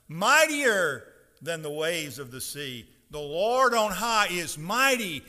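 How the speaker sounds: loud, slow scripture proclamation; forceful pulpit reading with drawn-out stresses. Shown as a demonstration. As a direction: boom out the verse, leaning on the key words. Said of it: "raise my voice a bit".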